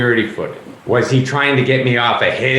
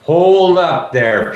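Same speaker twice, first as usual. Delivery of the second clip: drawn-out, firm stop; slow authoritative call to halt; slow commanding halt; 6 semitones higher, 3 dB louder, much slower than usual. Acted. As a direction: hold them back, slowly. Inspector halts the group.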